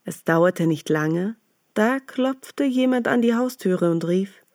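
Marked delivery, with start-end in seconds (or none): none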